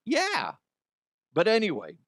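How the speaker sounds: ordinary delivery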